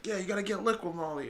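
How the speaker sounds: lightly voiced young clerk. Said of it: "he's like".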